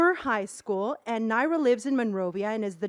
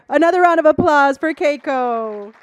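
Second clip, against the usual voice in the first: big booming cheer for the crowd; loud announcer call; 2 semitones higher, 7 dB louder than usual. Real, not acted. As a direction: call it out to the whole room. Host hyping the crowd.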